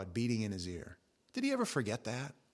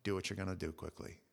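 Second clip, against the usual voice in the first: low, softer private words; quietly; 5 semitones lower, 8 dB quieter than usual. Retold or imitated. Jesus speaks privately to Judas so only John hears, quiet. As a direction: say it so only one can hear. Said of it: "he says to him quietly".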